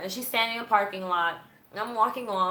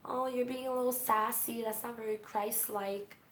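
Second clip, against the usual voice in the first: higher, prim imitation of a scolding church member; mocking church scold; 4 semitones higher, 4 dB quieter than usual. Church members scolding her for being sassy, in a prim mock voice. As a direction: parody the preachy lecture, lightly mocking. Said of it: "then it's like".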